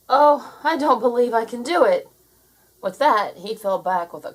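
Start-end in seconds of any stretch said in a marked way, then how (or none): 0.1-2.1 higher, louder cry of self-doubt; despairing Jake. A narrator's reading. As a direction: Jake giving up with a wail. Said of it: "he once again thought".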